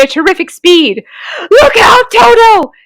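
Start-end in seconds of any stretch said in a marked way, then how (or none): 1.5-2.7 high loud cry of alarm; shouted warning. A narrator's reading. Humpy Rumpy to Toto, warning of the crocodile: loud high shout. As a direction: yell it to save him. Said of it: "shouted Humpy Rumpy".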